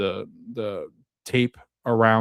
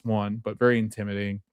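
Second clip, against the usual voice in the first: usual speaking voice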